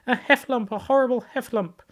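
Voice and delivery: high, loud panicked squeal; a tiny pig shrieking in terror; terrified cry. A narrator's reading. Piglet fleeing the trap in high terror. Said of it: "cried Piglet".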